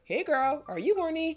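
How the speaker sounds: high, loud sing-out; hammed-up high, perky mock greeting; imitating a chirpy app. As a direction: pipe up like an overfriendly notification. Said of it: "the Flow app being like".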